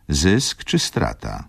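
plain reading voice

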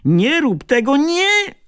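very high, loud terrified cry; shrill cry of panic, very high on nie; panicked shout. A narrator's reading. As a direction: scream the warning as the shutter opens. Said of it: "zdążył tylko krzyknąć".

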